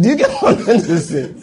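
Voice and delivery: laughing while asking the congregation. Real, not acted. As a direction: still laughing as you ask. Still laughing after punchline.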